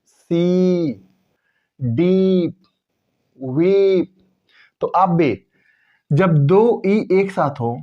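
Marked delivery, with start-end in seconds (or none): 0.1-4.2 सी, डीप, वीप each with a stretched ee; long ee. Shown as a demonstration. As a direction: draw out every ee. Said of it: "ई का उच्चारण को लंबा खींचा". (तीनों शब्दों में लंबी ई)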